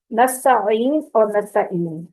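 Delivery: نستعین said slowly twice, first with the wrong vowel, then correctly; slow it down and set the wrong نستعین against the right one; contrasting two pronunciations. Shown as a demonstration. Teacher contrasting two readings of نستعین for her students. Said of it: "فرق ہے نا دونوں میں".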